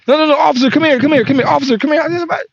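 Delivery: a driver yelling at the officer to come back; calling out to officer. Pretend. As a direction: shout after the leaving cop, come here. Calling cop back urgently.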